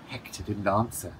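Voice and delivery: ordinary delivery, nothing marked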